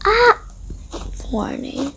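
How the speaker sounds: usual speaking voice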